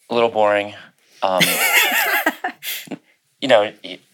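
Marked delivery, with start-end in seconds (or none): 1.5-2.9 high laugh. Real, not acted. Amused at boring friend.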